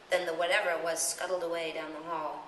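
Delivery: plain reading voice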